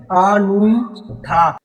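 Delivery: अनूठा stretched very slowly, syllable by syllable; slow syllable breakdown. Shown as a demonstration. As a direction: draw out each part of अनूठा with a gap before the last. Teacher stretches the new word for class.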